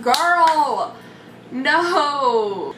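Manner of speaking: firm, drawn-out no with attitude; sassy girl no. Retold or imitated. To the student filing her nails, a sassy refusal. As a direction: shut her down, no-nonsense.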